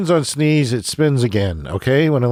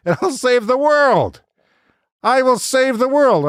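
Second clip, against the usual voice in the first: mock heroic boast; 9 semitones higher than usual.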